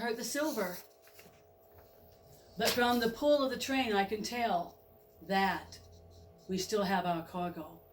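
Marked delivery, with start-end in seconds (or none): none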